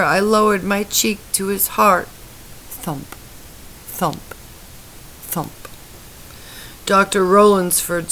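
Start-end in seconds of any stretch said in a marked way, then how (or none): 2.7-5.5 faint heartbeat. A narrator's reading. Heartbeat sounds.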